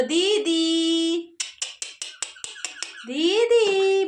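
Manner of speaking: long, held दीदी; word demo for parrot. Shown as a demonstration. Coaxing the parrot to speak, slow and stretched. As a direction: draw दीदी out very slowly for copying. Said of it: "बोलो".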